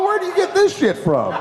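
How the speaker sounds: high, incredulous laughing question. Retold or imitated. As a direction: laugh out the disbelief. Laughing quote to the scout.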